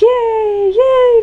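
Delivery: high, loud drawn-out yay; happy cheer. Acted. Renamed smiley celebrates turning into happiness, high happy cheer. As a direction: squeal it like a delighted little character. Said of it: "he's all happy".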